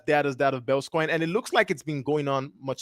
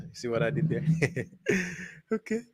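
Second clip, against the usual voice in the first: soft chuckling through the words; quiet, pleased laughter; laughing at own pun; 8 dB quieter than usual. Real, not acted. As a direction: giggle at your own joke. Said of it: "Feeling a little smart here".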